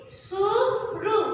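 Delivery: very slow, stretched blending of सुरु; sounding out words. Shown as a demonstration. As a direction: draw सुरु out syllable by syllable. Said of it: "क्या पढ़ेंगे?".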